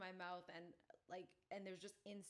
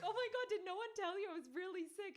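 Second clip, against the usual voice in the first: laughing protest; 14 semitones higher, 8 dB louder, faster than usual. Retold or imitated. Defending herself laughing.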